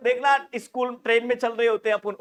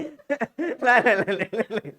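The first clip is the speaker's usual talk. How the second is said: laughing no; 2 semitones higher than usual. Real, not acted. Laughing, denying it was funny.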